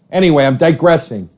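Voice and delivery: normal talking voice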